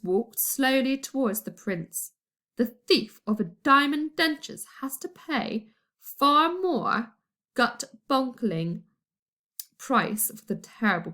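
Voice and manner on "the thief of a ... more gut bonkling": ordinary delivery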